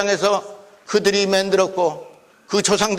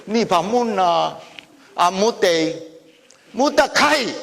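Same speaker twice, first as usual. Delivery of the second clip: native Gyeongsang back-and-forth; Gyeongsang dialect; slower than usual. Shown as a demonstration. Lecturer demonstrating local speech to listeners, Gyeongsang dialect. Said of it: "경상도 토속 사투리".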